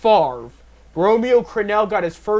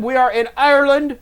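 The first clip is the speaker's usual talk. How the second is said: a light Irish accent, higher; 8 semitones higher, 6 dB louder than usual. Shown as a demonstration. Announcing the London game, a lightly done Irish accent. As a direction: try a quick Irish accent. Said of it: "That's my Ireland accent".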